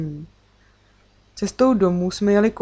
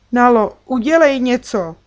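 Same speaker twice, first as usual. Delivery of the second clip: loud, higher cry for help; urgent raised-voice appeal; calling out; 4 semitones higher, 6 dB louder than usual. A narrator's reading. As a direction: shout to a friend to act. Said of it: "křikla jsem na kamarádku".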